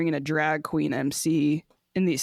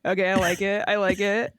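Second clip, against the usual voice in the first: ordinary talk, nothing marked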